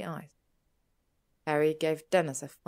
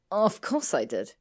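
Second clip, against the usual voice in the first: loud, tart retort; 3 semitones higher, 6 dB louder than usual. A narrator's reading. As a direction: answer back tartly. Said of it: "Hermione answered tardily".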